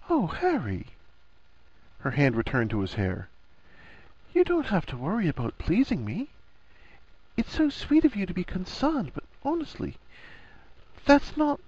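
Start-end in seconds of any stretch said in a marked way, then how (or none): 0.1-0.9 high, tender sigh of sympathy; so sweetly. A narrator's reading. Hermione comforting her anxious friend, sweet. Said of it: "so sweetly". 4.3-11.6 high, soft woman's character voice; Hermione, sweet reassurance. A narrator's reading. Hermione soothing Harry's worry about pleasing her, sweet.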